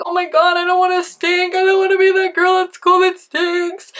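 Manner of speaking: high-pitched crying whine; exaggerated tearful complaint, held high; mock crying. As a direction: wail it up high like a tearful teen. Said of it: "like, crying".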